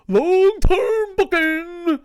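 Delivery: very high, slow, loud shout; drawn-out yelled cry; yelled exclamation. Real, not acted. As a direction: bellow it like a headline. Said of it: "I'm, like, yelling about pro wrestling".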